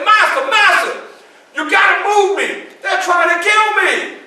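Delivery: high, loud frantic begging; desperate cry for help; panicked pleading. Retold or imitated. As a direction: beg for your life, out of breath.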